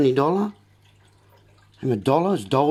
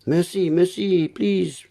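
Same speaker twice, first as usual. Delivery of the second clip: slow, higher pleading plea; begging beggar; 4 semitones higher, slower than usual. Retold or imitated. Beggars begging for money, slow pleading voice. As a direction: plead like a beggar asking for coins. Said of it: "They were begging for money".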